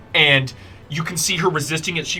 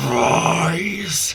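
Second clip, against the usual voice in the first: angry command; 4 semitones higher than usual. Acted. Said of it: "and she goes".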